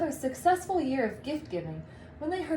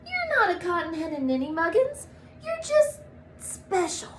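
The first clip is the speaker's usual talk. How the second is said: much higher, light elf voice; comforting elf; 7 semitones higher than usual. Acted. A fellow elf consoling Buddy in a much higher voice. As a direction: reassure him sweetly.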